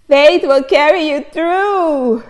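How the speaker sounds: declaring the verse with fervour; proclaimed. Real, not acted. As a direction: proclaim it triumphantly.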